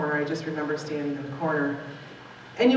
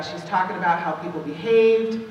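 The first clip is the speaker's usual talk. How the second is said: ordinary talk, nothing marked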